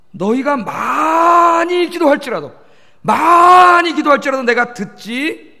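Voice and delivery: 많이 drawn very long, high and loud; emphatic proclamation. Real, not acted. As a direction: stretch the first vowel of 많이 in a swelling cry. Preacher hammering a repeated verse. ("많이"를 높고 길게 늘여 강조)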